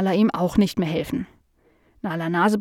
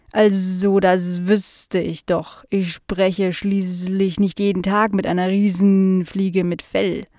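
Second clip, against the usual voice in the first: slow housefly with drawn-out buzzing s sounds; curious housefly; slower than usual. Acted. Fly meeting Nala for the first time, slow and buzzy. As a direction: stretch the words like a dopey, curious fly.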